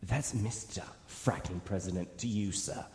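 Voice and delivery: slow, lightly stern commander voice; slow hint of a stern leader; mock stern president. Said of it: "he's just like".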